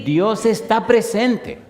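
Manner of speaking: "Dios está presente" alto, fuerte y lento; énfasis predicador. Real, not acted. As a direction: recalca cada palabra como verdad de fe. (predicando con fuerza que Dios está presente)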